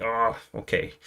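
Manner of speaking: mildly sulky imitation; salty and grumpy. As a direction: give in with a touch of salt. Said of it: "kinda salty and grumpy".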